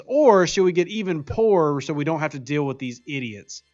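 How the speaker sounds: ordinary talk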